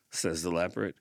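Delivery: plain reading voice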